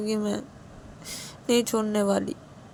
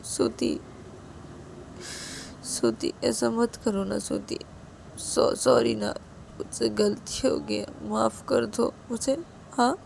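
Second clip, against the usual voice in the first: tearful pleading; 6 semitones higher than usual. A narrator's reading. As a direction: plead through tears, voice wavering high. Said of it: "Siya की आंखों से आंसू गिरने लगते हैं". (रोते हुए गिड़गिड़ाना)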